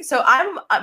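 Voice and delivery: ordinary delivery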